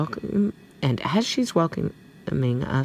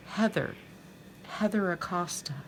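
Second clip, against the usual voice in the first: higher, little innocent voice; 6 semitones higher, 5 dB quieter than usual. A narrator's reading. Student giving her name to the stern teacher, innocent. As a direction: answer sweetly, as if blameless. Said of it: "She gives her an innocent little look".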